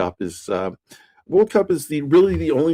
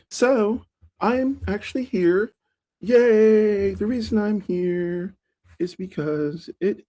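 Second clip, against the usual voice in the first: higher pitch; 8 semitones higher than usual. Real, not acted. Streamer rambling to an empty live chat, high-pitched voice. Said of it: "I'm talking in a higher pitch".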